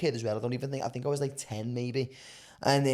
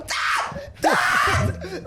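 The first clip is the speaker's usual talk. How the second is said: unvoiced yelled call for dad, high and loud; panicked shout; 12 semitones higher, 4 dB louder than usual. Kid shouting for his dad as the van rolls away, panicked.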